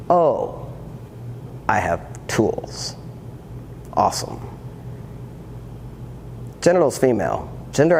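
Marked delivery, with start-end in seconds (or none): 0.1-4.4 dry, quiet, slow sarcasm; deadpan it, thoroughly unimpressed; sarcastic. Real, not acted. Mocking therapist's advice.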